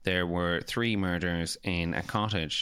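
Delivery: low, slow monotone news read; same-tone newsreader. Retold or imitated. Radio newsreader reading lottery win, unvaryingly solemn. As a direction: parody a newsreader who never varies tone. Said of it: "they have the same tone no matter what".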